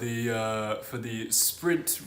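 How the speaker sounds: loud, bright TV pundit opening the broadcast; Sky Sports reporter voice. Pretend. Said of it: "put on my Sky Sports, uh, reporter voice".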